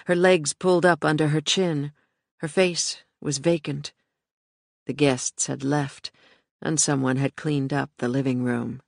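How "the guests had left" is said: ordinary delivery, nothing marked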